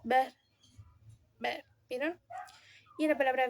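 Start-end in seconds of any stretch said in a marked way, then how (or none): 0.0-1.7 "Ver" with lips barely approaching, no full closure; soft v demo. Shown as a demonstration. Student showing the soft b between vowels. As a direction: let the lips nearly touch on "ver". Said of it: "apenas se aproximan mis labios".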